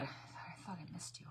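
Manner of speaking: a soft, breathy aside; whispered relief. Real, not acted. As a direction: whisper "I thought I missed you" softly.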